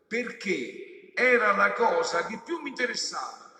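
loud, high, driving sermon voice, slowing on the Latin; preaching at full force. Acted. Preacher urging his flock, full-force preaching. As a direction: hammer the point home to the flock.

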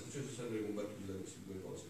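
ordinary delivery

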